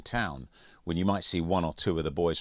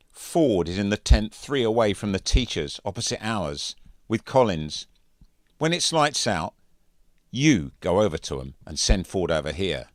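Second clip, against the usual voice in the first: louder, commanding schoolboy voice; bossy bully orders; 4 semitones higher, 7 dB louder than usual. Acted. Andrews ordering Mitchell to fetch Ford, loud bossy voice. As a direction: lay down the plan like a boss.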